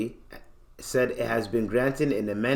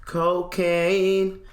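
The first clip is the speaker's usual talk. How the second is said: mock dramatic echo; 6 semitones higher than usual. Streamer echoes a quoted word.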